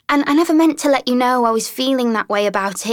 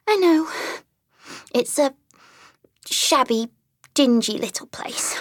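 sobbing brokenly; 8 semitones higher than usual. A narrator's reading. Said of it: "she sobbed forth at last, brokenly".

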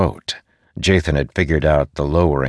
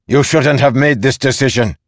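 raised, high-pitched scolding; angry reprimand; 8 semitones higher, 5 dB louder than usual. Acted. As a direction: berate a subordinate loudly. General berates Zeth.

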